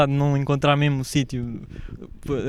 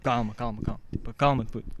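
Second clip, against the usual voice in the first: a acalmar; 4 semitones lower, 7 dB quieter than usual. Retold or imitated. Estranho acalma o rapaz aflito.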